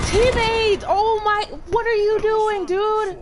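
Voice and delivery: shrieking at a teammate; yelling. Real, not acted. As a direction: yell it out in disbelief. Teammate blunders, streamer yells.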